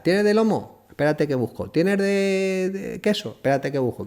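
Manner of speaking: high, eager customer voice pitched well up; mimicking hungry fans. The storyteller imitates stadium fans asking for sandwiches, high and eager. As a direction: play a pushy fan begging for a sandwich.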